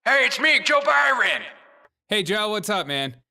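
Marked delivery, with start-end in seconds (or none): 0.1-1.4 loud, high caller voice; hammed-up politician phoning in; Joe Biden. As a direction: shout a comic old-politician impression down the phone. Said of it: "Joe Biden's calling".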